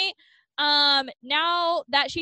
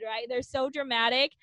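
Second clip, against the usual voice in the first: ordinary talk, nothing marked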